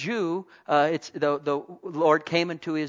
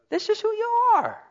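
much higher, ringing out; soaring affirmation; 4 dB louder than usual. Acted. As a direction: lift it up triumphantly to the church.

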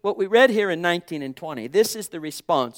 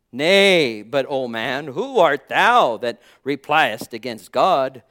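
loud scripture reading; 4 semitones higher, 7 dB louder than usual. Acted. Pastor reading Romans to the congregation, loud declaiming. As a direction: declaim the King James verses, loud and weighty.

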